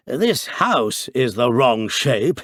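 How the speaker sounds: slow, loud teasing echo of "wrong shape"; mock the priest's words heartily; jolly mockery. A narrator's reading. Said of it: "said the jolly Harris".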